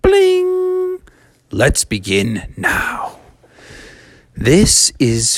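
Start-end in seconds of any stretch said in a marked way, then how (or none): 0.0-1.0 very high sung bling like a chime; chime sound. Shown as a demonstration. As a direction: imitate a ringing chime with your voice. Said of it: "hear the chimes ring like this".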